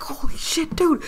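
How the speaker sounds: high, breathless holy shit; a touch of leftover panic; relieved panic. Retold or imitated. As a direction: gasp it, barely safe. Reaching the dock.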